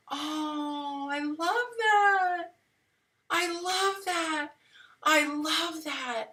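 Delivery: high, slow, tearful I love that; near tears. Real, not acted. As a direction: say it as your voice breaks with emotion. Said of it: "you're really gonna make me cry".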